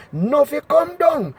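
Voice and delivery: usual speaking voice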